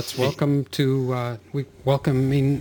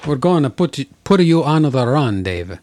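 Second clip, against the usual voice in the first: put-on Italian accent; 3 semitones higher, 4 dB louder than usual. Teasing an opponent before the game, mock Italian accent. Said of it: "is that my Italian accent?".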